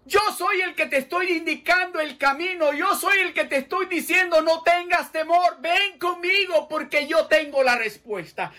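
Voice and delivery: high, loud preaching as the Lord; preaching at full force. Acted. Preacher voicing the Lord to the doubter, full preaching force. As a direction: proclaim the Lord's answer with force.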